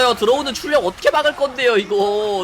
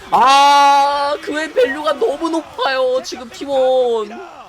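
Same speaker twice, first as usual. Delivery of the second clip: excited caster; 5 semitones higher, 5 dB louder, much slower than usual. Real, not acted. Caster hyping Gwen's teamfight.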